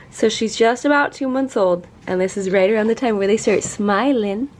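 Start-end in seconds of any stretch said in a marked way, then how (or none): none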